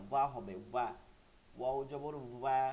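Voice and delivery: ordinary delivery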